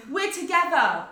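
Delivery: loud, high, emphatic declaration; exasperated insistence. Retold or imitated. Daughter setting the waitress straight, loud exasperated insistence. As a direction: raise the voice to make it clear. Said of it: "kind of screaming at each other".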